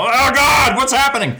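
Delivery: high, loud mock-panicked shout. Telling friends about the backstage fight, a high, loud mock-panicked cry. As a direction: squeal it out like you're about to see a fight break out. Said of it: "I was like".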